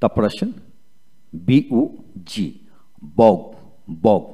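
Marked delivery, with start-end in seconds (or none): 3.2-4.2 বগ ending on a hard G, no U sound; silent U. Shown as a demonstration. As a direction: model বগ as one short syllable closing on G. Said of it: "ইউ উচ্চারিত হবে না।". (বগ, শেষে ইউ অনুচ্চারিত)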